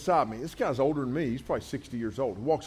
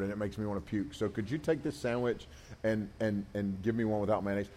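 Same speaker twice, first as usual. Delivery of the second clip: softly apologetic, quieter and lower, timid and sheepish; meek apologetic customer; 5 semitones lower, 6 dB quieter than usual. Pretend. Customer politely asking the cashier for no mayo, meek and apologetic. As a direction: beg for the sandwich swap like a self-conscious wuss.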